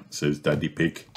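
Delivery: plain reading voice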